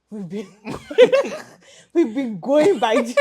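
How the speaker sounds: breaking up laughing, pitch climbing. Real, not acted. Admitting the class name to the host, laughing. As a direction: laugh through the words, loud and drawn out.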